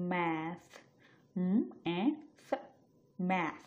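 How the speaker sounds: "ম্যাথ" broken into m, a, th, then whole; teacher segmenting "ম্যাথ"; sound-by-sound demo. Shown as a demonstration. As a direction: spell out the sounds of "ম্যাথ" one by one, with pauses. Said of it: "সবগুলো সাউন্ডের একটা নির্দিষ্ট".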